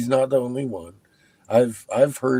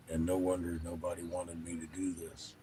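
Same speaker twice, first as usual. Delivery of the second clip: whispered aside; 3 semitones lower, 13 dB quieter than usual. Real, not acted. Speaker whispering a confiding aside to his viewers. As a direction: whisper it, softer.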